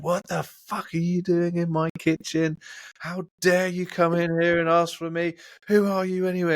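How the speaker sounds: an intimidating chef tearing into an intruder; mock angry chef. Chef throwing the salesman out of his kitchen, mock furious. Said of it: "He was like".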